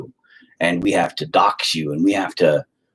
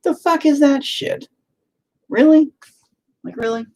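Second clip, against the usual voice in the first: exasperated disbelief; 3 dB louder than usual. Real, not acted. Man reacting to his wife refusing to apologise, in high incredulous disbelief. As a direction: let your jaw drop at the nerve of it.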